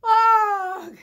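loud high startled squawk; a fright noise as he bolts; startled turkey grunt. Acted.

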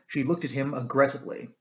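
ordinary delivery, nothing marked

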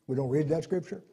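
normal talking voice

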